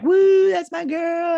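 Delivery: high, loud imitated whoop from the stands; cheering. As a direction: whoop it up slowly like a proud parent. Imitating cheering parents at game.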